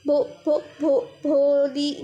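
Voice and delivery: slow broken stutter on the name; child stammering her name, drawn out. Retold or imitated. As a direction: stammer out the first syllable over and over, slowly. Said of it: "पूरा भी बोल नहीं पाती".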